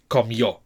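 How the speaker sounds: final yo of comió stressed. Shown as a demonstration. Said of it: "the stress is on the O".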